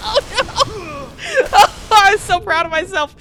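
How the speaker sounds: high, loud laughing wail; half laughing, half crying at yourself; real laughing despair. Real, not acted. Laughing despair after defeat.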